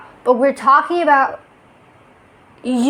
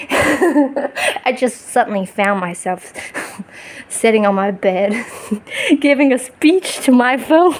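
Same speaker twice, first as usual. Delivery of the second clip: talking through real giggles; laughing. Real, not acted. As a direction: break into laughter at yourself between phrases. Amused at lecturing the phone.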